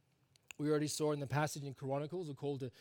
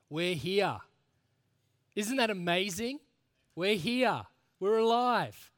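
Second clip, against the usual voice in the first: building higher and louder to a big finish; rousing celebration; 4 semitones higher, 6 dB louder, slower than usual. Real, not acted. Preacher rallies congregation who survived another week, jubilant. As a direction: proclaim it with mounting amazement.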